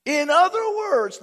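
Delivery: ordinary delivery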